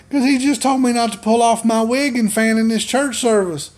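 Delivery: very high-pitched mock mama voice; imitated indignant mother. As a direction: tattle in a hammed-up, high mother's voice. Mother tattles to preacher, imitated.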